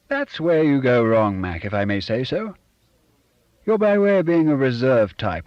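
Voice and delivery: hearty fake-posh chum; Bob's fake genteel voice. A narrator's reading. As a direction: play the smug, put-on gentleman. Bob lectures Alec.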